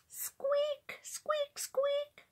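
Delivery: very high, thin squeaks; high-pitched squeaking door imitation. A narrator's reading. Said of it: "sounded the door".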